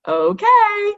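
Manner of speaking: bright, high grinning okay; a grinning, excited okay. A narrator's reading. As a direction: say it grinning with delight. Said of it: "Mia grinned".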